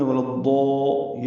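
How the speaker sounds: ولا الضا slowly, with a full, heavy ضاد and الف; careful tajweed demo of ولا الضا. Shown as a demonstration. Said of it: "اس کا الف مدہ بھی پر ہے".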